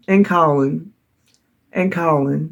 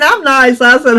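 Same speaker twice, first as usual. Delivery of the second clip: usual speaking voice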